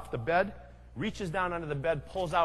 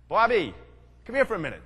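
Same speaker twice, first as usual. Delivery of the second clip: loud, high call down the hall; older brother calling his little brother in, loud and sly; calling out to Bobby; 4 semitones higher, 6 dB louder, faster than usual. Retold or imitated. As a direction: sing out a sly summons to the kid. Brother calls Bobby into the room.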